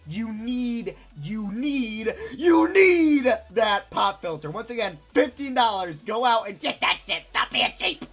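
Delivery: loud, insistent push to buy; emphatic urging. Real, not acted. YouTuber scolding viewers to buy a pop filter, loud and insistent.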